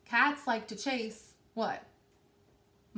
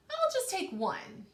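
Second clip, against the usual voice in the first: high little mouse voice; 10 semitones higher than usual. Acted. Teacher voicing the mouse for her class, high and sly. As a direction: squeak it like a tempted mouse. Mouse's greedy thought in story.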